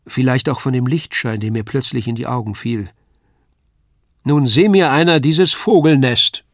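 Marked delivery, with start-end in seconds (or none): 4.2-6.4 louder, bemused father's voice; a father finding the children asleep; amused father. A narrator's reading. Father finds the kids in the crate, amused. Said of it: "die Stimme meines Vaters".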